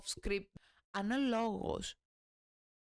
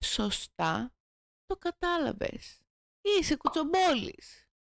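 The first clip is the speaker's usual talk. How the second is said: louder, slow and emphatic, pitch up; punchy accusation; 5 semitones higher, 6 dB louder, slower than usual. Acted. Retake to the viewer, loud slow accusing punchline. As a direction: hammer the accusation home slowly.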